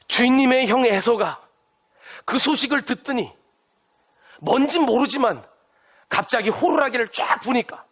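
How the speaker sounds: a panicked servant blurting out the news; alarmed messenger. Retold or imitated. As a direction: messenger running in to warn Jacob, alarmed. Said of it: "메신저가 깜짝 놀라가지고 막 뛰어왔어요".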